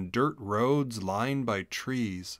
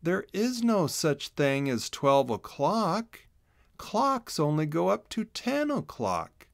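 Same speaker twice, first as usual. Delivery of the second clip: slow and deliberate, higher character voice; slow, deliberate statement; 6 semitones higher than usual. Acted. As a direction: state it slowly, word by word. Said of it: "slowly stated".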